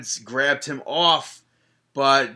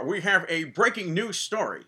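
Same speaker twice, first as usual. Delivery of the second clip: put-on second try, a lightly changed old-time news voice; 1940s newsreader Big E; 4 semitones higher than usual. Host retries the impression after judging it wrong. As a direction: redo the newsreader line in a different timbre. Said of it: "No, that doesn't sound like him".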